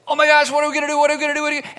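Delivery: hammed-up alarm over running out of room; mock panic. Church panicking over space in January.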